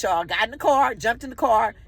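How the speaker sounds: ordinary talk, nothing marked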